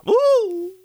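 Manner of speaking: high, loud "woo"; excited whoop. Real, not acted. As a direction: let out a sudden preacher's whoop. Preacher thrilled by his own point.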